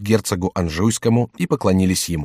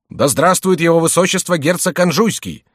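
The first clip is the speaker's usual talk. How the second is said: higher-pitched cheer for the duke; crowd acclamation; 8 semitones higher than usual. A narrator's reading. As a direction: cry it out like an acclaiming crowd, without full shouting. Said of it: "закричали все присутствующие". (возглас приветствующей толпы)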